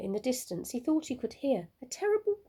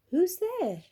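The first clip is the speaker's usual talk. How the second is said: higher, curious owl voice; little owl asking; 9 semitones higher, 4 dB louder than usual. A narrator's reading. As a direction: call out lightly, wondering. Olive asks who answered.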